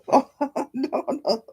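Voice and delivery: high giggling no; laughing. Real, not acted. Laughing at own slip.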